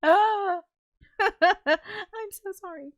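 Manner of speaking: slow, high apology through laughter; laughing. Real, not acted. Apologizing to viewers while laughing, high and slow. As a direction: giggle the apology out.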